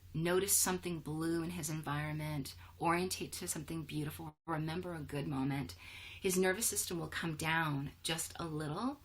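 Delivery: ordinary delivery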